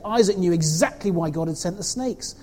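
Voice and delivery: usual speaking voice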